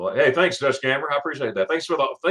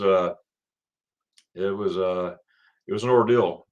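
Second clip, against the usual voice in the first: usual speaking voice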